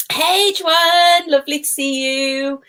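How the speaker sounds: cheery high hello to Joanne; excited greeting. Real, not acted. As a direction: greet a friend joining the live. Greeting a live viewer.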